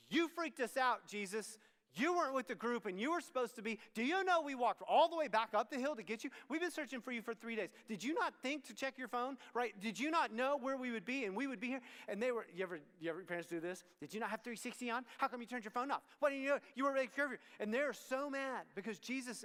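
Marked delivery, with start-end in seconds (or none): 0.1-11.8 louder, higher parental scolding; frantic parents. Acted. Voicing frantic parents to Jesus. 14.0-17.4 louder, higher nagging; frantic parents. Acted.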